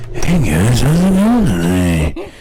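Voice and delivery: hammed-up seductive DJ; sexy voice. Shown as a demonstration. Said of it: "My sexy voice".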